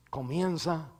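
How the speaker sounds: usual speaking voice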